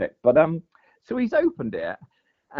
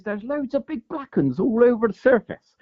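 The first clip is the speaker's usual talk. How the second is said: higher, slow mock woman's voice; paddleboarder woman; 10 semitones higher than usual. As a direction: draw it out high like the excited paddleboarder. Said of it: "she goes".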